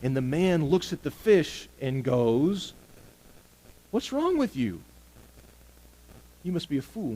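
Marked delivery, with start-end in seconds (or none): 3.9-7.2 high-pitched mock scolding of a fool; mock incredulity. Man in a story telling off a silly fish, mock incredulous. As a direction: act out baffled disbelief, pitched well up.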